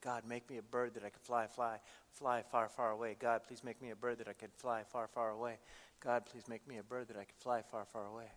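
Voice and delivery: low, soft retold plea; quiet prayer. Girl running from her dad prays in a cornfield, hushed plea. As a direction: murmur it as a repeated prayer. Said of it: "and she starts praying".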